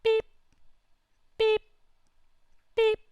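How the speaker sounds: short, high electronic beep, evenly spaced; little warning beep. Retold or imitated. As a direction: imitate an alarm pinging with long gaps. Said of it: "there's a little beep".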